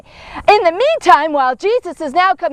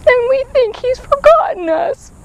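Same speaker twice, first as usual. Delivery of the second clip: ordinary talk, nothing marked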